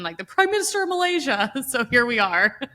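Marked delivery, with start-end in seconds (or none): 0.2-1.5 higher, lightly mocking movie-dimwit voice; Zoolander. Mocking a confused politician as Zoolander, light high-pitched impression. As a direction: play him as a confused dope, pitched up. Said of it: "one step away from, like, Zoolander".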